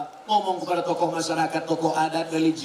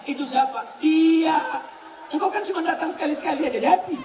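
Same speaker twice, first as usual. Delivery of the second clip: rising preaching climax; 5 semitones higher than usual. Acted. Preacher to husbands about wives, building fervor. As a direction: preach it up to the peak.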